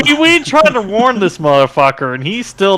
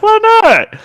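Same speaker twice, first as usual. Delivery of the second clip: mock innocent; 5 dB louder than usual. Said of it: "And you're just like".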